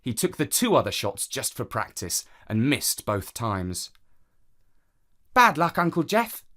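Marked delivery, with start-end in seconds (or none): 5.3-6.4 put-on higher, lilting little girl's line; higher-pitched child's commiseration; child character. A narrator's reading. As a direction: voice a niece consoling her uncle, pitched up. Child character consoling uncle in story.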